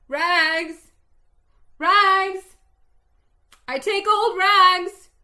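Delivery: high, ringing vendor's cry. Acted. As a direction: call out, wounded yet strong. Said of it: "cried the sobbing, bleeding".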